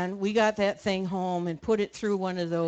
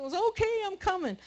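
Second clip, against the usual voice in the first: husband answering; 10 semitones higher, 4 dB quieter than usual. Retold or imitated. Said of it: "And he said".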